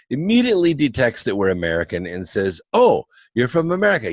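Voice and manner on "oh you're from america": high, quick burst of delighted surprise; excited waitress. Retold or imitated. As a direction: imitate a thrilled young waitress. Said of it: "she was really excited about it".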